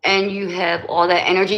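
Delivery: ordinary talk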